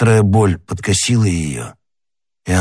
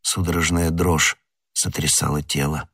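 usual speaking voice

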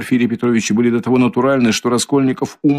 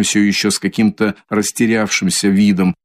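plain reading voice

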